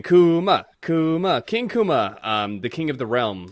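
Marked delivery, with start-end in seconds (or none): 0.0-2.2 loud chant of his name; cheering. Retold or imitated. Crowd cheering Kuma as king, loud chant. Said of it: "everyone's cheering".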